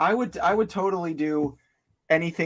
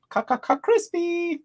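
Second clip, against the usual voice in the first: silly catchphrase voice. Pretend. Goofy catchphrase.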